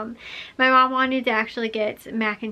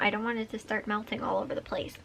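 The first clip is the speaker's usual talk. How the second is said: whispering; 9 dB quieter than usual. Real, not acted. Quiet aside in vlog.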